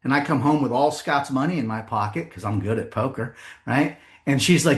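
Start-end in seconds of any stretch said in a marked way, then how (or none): none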